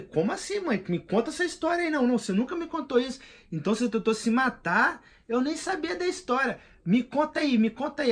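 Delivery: urgently begging his mother for the story; eager pleading. Retold or imitated. Son begs his mother to tell the story, eager high pleading.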